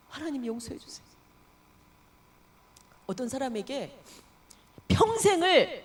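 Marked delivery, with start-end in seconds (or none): 0.1-1.1 hushed plea. Acted. Sinner begs forgiveness.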